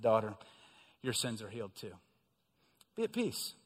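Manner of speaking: low, soft, gentle blessing; tender Jesus. Acted. Jesus gently blessing the healed woman, low and tender. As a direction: speak to her like a loving father.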